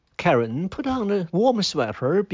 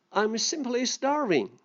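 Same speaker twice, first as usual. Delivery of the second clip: a higher, lightly voiced begging grasshopper; pleading grasshopper; 8 semitones higher, 3 dB quieter than usual. Grasshopper begs ants for food.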